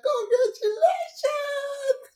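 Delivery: very high, slow, drawn-out congratulations; sing-song forced congratulations; mock congratulations. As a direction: stretch it out in a strained, fake-cheerful squeal. Mom's strained greeting retold.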